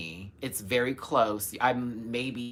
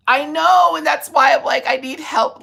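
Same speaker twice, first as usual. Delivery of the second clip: excited; 12 dB louder than usual. Real, not acted. Excited plea for help.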